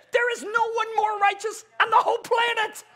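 high, forceful proclamation; high-pitched, emphatic preaching cry; God's declaration. Acted. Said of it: "God is the one that said".

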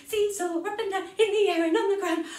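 tiny fast seesaw; fast pixie seesaw. Shown as a demonstration. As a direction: rush through it like pixies. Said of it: "go really fast".

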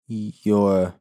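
croaked. A narrator's reading. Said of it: "he croaked".

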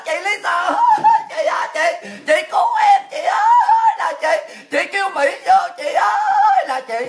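very high, loud wailing cries; mocking panicked yell, voice leaping way up; mock screaming for help. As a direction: shriek out a hammed-up plea to big sister. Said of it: "lúc đó là la làng lên".

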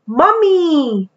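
usual speaking voice

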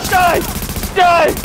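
high battle shout; battle yell. Real, not acted. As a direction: yell it at the enemy. Shooting enemies.

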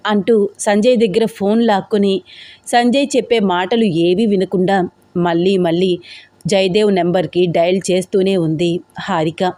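usual speaking voice

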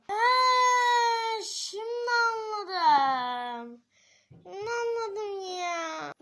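drawn-out slow "now I get it"; slow realisation. Acted. Character finally understanding, slowly drawling. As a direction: stretch it out as it dawns.

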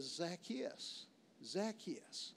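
hushed calling of a name; soft calling. Acted. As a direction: call his name softly upward. Jesus calls up to Zacchaeus.

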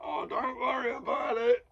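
strained high imitation of a player; squeaky-high mock reassurance; high-pitched brush-off. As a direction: act out a high, put-on "it's nothing". Player brushing off manager's back slap.

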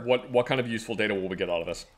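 low, gruff boss voice; goofy muffled sock puppet. Acted. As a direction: play a pompous low-voiced official. Plays the official in the skit.

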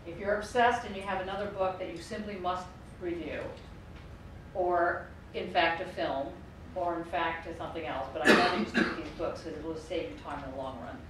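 ordinary talk

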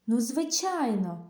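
usual speaking voice

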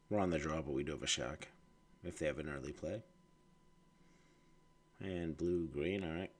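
stuffy nose, nasal and breathy. Real, not acted. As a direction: talk through a blocked nose. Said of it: "my nasally voice and heavy breathing".

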